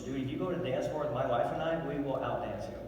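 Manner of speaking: ordinary delivery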